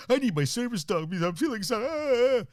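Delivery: mocking whiny dog owner. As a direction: ham up a fragile owner's moaning, rising to a wail. Mocking needy service dog owners.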